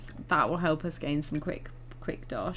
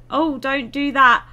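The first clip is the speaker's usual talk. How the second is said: loud, drawn-out dismay with a gasp; alarmed groan of regret; dismayed outburst; 7 semitones higher, 10 dB louder than usual. Real, not acted. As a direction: react in shock at a blunder. Sim makes a bad move.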